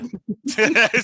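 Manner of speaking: bursting out laughing; a big real laugh at a friend's expense; loud laugh. Real, not acted. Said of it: "[name]'s already laughing".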